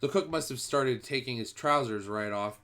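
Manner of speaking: ordinary delivery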